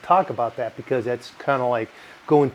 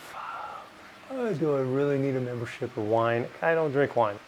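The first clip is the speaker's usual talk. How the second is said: muttered complaint; 8 dB quieter than usual. Retold or imitated. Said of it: "I'm like".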